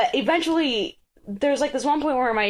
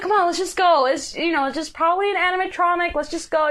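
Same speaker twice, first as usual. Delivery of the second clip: nervous coaxing; 6 semitones higher, faster than usual. Retold or imitated. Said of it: "she's just like".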